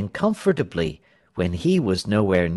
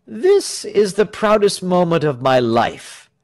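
higher, louder public oratory; speechmaking; 6 semitones higher, 5 dB louder than usual. A narrator's reading. Tell addressing cheering countrymen, speechmaking. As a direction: proclaim it to a crowd.

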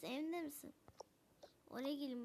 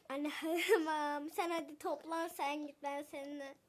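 ordinary delivery

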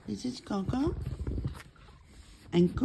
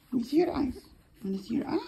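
usual speaking voice

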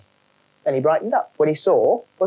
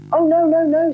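lightly raised gopher voice; a polite little gopher; 13 semitones higher, 4 dB louder than usual. Acted. Gordon the gopher speaking.

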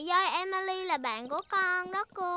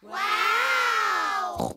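amazed wow; 5 dB louder than usual. Acted. Amazed by Emily's loud trumpet.

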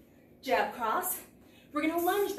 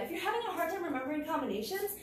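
ordinary talk

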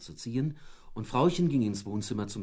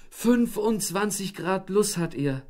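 moaning despair; 9 semitones higher, 4 dB louder than usual. A narrator's reading. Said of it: "stöhnte Frauchen".

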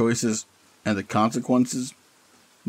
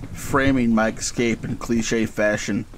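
ordinary delivery, nothing marked

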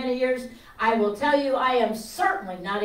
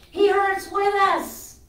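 slow heartfelt emphasis; 8 semitones higher, 5 dB louder, much slower than usual. Acted. Preacher slows for emotion.

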